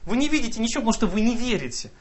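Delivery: ordinary delivery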